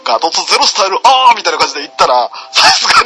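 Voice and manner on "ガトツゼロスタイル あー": mock anime shout. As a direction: imitate an over-the-top dubbed attack call, exploding at the end. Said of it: "あんな大声で叫んで". (技名から大声の叫びへ盛り上げる物真似)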